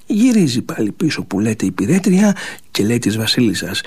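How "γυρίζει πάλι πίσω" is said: plain reading voice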